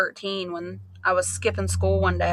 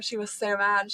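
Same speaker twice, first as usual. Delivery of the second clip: normal talking voice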